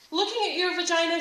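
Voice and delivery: a hint of a too-busy interviewee; busy businesswoman.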